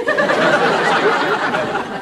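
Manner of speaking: a high, breathy burst of laughter; airy incredulous laugh; high amused laugh. Acted. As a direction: laugh off the idea you have an accent. Laughs at policeman's accent remark.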